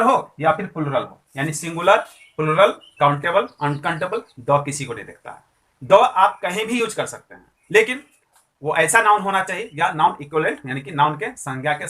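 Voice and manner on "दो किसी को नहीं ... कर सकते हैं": usual speaking voice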